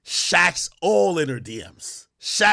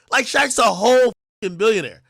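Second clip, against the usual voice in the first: ordinary talk, nothing marked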